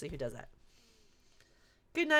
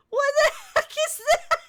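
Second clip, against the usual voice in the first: laughing; 5 dB louder than usual. Real, not acted. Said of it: "Kiddo says Chihuahua".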